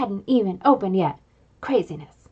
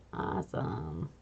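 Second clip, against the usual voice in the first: hushed echo; 11 semitones lower, 16 dB quieter, slower than usual. A narrator's reading. Boy admiring his own drawing, whispered awe. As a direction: drop to a low breathy hush on "Awesome".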